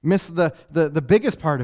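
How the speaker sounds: loud, driving sermon build; fiery preaching. Acted. Preacher driving home the disciples' panic, loud. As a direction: preach it hard to the crowd.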